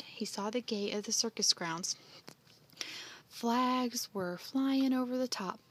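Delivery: usual speaking voice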